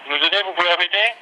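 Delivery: straining over a bad satellite line; asking again over a laggy call; satellite phone delay. Real, not acted. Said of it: "nous sommes en liaison satellite".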